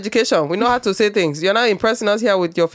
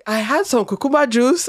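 higher-pitched Nigerian quote, cucumber as koo-KOOM-bah; Nigerian accent; 6 semitones higher than usual. Retold or imitated. Retelling a funny order to co-host, Nigerian accent. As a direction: imitate a Nigerian saying "cucumber juice". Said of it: "I actually love the Nigerian accent of saying".

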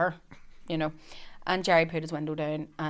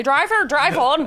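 slow, louder hammed-up order; mock command; 8 semitones higher, 8 dB louder, slower than usual. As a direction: bark it slowly through a laugh. Gerry ordering driver, retold.

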